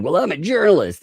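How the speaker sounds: high, loud mocking quote; put-on indignant insistence; mock self-importance. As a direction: protest it like a puffed-up amateur. Mocking a self-styled journalist.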